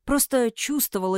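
plain reading voice

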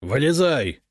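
brisk commanding call. A narrator's reading. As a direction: order him up out of the hole. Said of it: "скомандовал ему Прудников".

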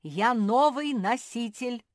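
emphatic loud claim; loud announcement. A narrator's reading. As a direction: declare it grandly, stretching the words. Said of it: "громко сообщила Мафи".

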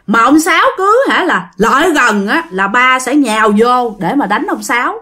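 ordinary delivery, nothing marked

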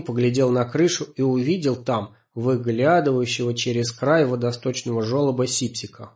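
plain reading voice

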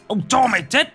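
furious scream. A narrator's reading. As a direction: scream the death threat. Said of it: "Ông rít lên". (hét lên điên cuồng)

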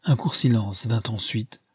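plain reading voice

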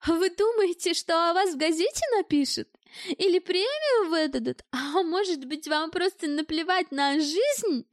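high, needling rhetorical questions; sarcastic taunting. A narrator's reading. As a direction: taunt him with rising mock questions. Woman taunts the sweating man.

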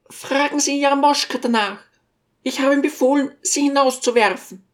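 high-pitched lady's character voice; commanding lady. A narrator's reading. Lady refers the teacher to her servant, high and commanding. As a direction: deliver the dismissal high and firm. Lady announces she had him thrown out.

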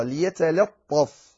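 slow ليتلطف with a thin L; light lam. Shown as a demonstration. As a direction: keep the L of ليتلطف clear before ط. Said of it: "اللام مرققة".

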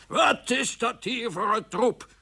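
slow, high, menacing rant; threatening. Acted. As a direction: draw each word out in threat. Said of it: "riep hij dreigend".

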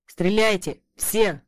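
loud command; shouting. A narrator's reading. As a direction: yell it to everyone. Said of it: "крикнул я".

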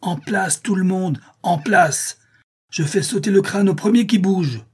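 high, loud shouted order; commanding shout. A narrator's reading. Harry orders the fleeing men back, shouting over the storm. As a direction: roar over the storm to stop the panic. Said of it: "d'une voix qui domine le fracas".

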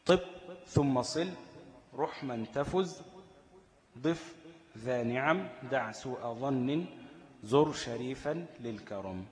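drawn-out recited poem line; slow verse recitation. Acted. Teacher reciting the verse of the sun letters, slowly. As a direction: recite each word slowly and deliberately.

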